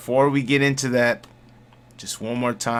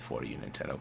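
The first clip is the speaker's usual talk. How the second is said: much softer, dropped pleading aside; 11 semitones lower, 13 dB quieter, faster than usual. Real, not acted. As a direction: murmur it to Nintendo.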